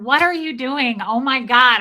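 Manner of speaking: re-enacted embarrassed outcry; flustered outburst. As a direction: blurt it out, mock exasperated. Acting out flustered street encounter.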